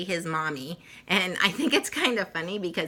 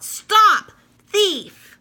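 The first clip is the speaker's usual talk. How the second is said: much higher, much louder furious yell; angry shout; 12 dB louder than usual. Acted. Gardener chasing the rabbit, furious shout. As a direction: bellow it after the thief. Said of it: "waving a rake and calling out".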